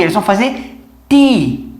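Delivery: ti said chee-like with mid-tongue; English ti. Shown as a demonstration. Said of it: "eles vão fazer o quê?".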